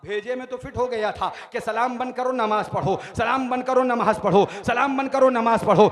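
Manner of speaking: loud, driving sermon narration; impassioned rhythmic declamation; full-force preaching. A narrator's reading. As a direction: build each line toward a climax.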